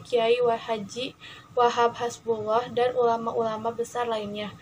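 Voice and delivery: ordinary delivery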